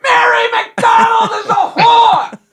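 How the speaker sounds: high, loud, slow yelled heckle; heckler yell. Retold or imitated. Heckler shouting at the emcee, loud and high. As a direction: bellow the insult from the crowd.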